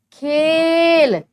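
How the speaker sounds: खेल very slow and loud with ए drawn out; stressing the vowel. Shown as a demonstration. Teacher sounding out खेल for the class. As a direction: stretch the ए in खेल. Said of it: "ए आ रहा है ना".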